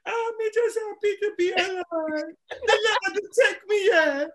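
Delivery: put-on high, loud, wailing old granny; hammed-up crying gran; old grandma voice. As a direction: play a quavering grandmother crying out her blessings.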